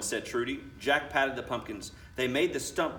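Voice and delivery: ordinary delivery, nothing marked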